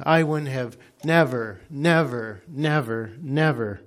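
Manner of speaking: repeated never pressed hard; insistent refusal. Retold or imitated. Demon swearing he would never have done it, pressing each never. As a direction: hammer each never home. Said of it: "And he says".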